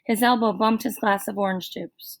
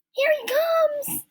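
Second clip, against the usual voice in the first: put-on high, slow eager girl; high girlish voice for Lori; slower than usual. A narrator's reading.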